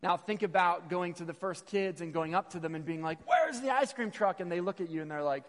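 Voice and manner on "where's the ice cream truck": put-on high, loud kid's demand; comically urgent, pitched up; mock frantic shout. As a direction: call it out like a kid desperate for ice cream. Replays the frantic kid's question.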